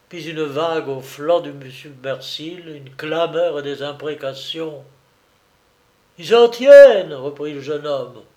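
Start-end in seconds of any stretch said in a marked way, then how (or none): 6.2-7.1 high, loud exultant call; triumphant cry. A narrator's reading. Young man cheering the hit on the enemy boat, excited cry. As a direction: crow over the hit.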